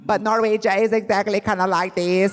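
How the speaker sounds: mock Norwegian accent. Shown as a demonstration. Self-declared Swede showing how Norwegians differ, lilting mock Norwegian accent. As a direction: put on a singsong Norwegian accent. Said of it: "Norwegian accent, I mean, it's hard for me".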